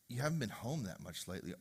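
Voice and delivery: soft, low, tentative complaint; timid confession. Acted. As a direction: venture it carefully, under your breath. Wife cautiously risks the truth.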